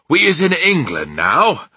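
high, loud giant's announcement; sudden exclamation. A narrator's reading. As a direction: burst out with sudden news. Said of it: "he said suddenly".

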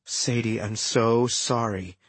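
hushed brotherly apology; gentle sympathy. A narrator's reading. Brother consoles grieving sister.

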